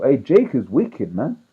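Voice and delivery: ordinary talk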